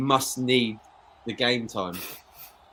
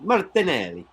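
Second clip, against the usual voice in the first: put-on a lighter, higher repeat of the name in an Italian accent; once more with a hint of Italian accent; 6 semitones higher, 4 dB louder than usual. Repeating the player's name to his mate in a light Italian accent. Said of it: "Why did I say that in an Italian accent?".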